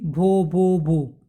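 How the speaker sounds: slow, halting stammer on the first syllable; a shy girl stuck on her own name, stammering; stammering child. Retold or imitated. Stuck on the first syllable. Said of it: "हकलाती थी भोली".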